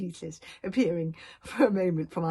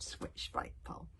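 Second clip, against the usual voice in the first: ordinary delivery, nothing marked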